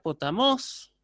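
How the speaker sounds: "potamos" with pitch climbing high on "mos". Shown as a demonstration. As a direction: lift the tone sharply on the last syllable of "potamos". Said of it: "elevando el tono".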